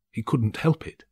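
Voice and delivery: ordinary delivery, nothing marked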